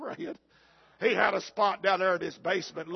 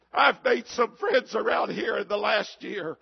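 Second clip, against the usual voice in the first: ordinary talk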